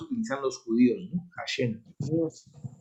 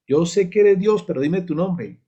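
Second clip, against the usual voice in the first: higher, louder insistent plea; insistent Moses; 5 semitones higher, 5 dB louder than usual. Acted. Moses presses God for his name, insistent. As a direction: press for an answer, pitched up. Moisés insiste en saber el nombre.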